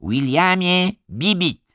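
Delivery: high-pitched, slow icy reprimand; each name drawn out with cold severity; stern, icy. A narrator's reading. Head nurse repeating the culprit's full name, stern and icy. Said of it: "tak surowo i lodowato".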